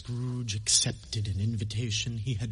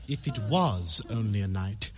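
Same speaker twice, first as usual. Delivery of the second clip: ordinary delivery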